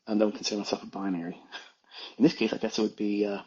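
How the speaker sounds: ordinary talk, nothing marked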